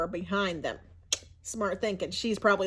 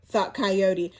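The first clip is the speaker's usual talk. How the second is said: plain reading voice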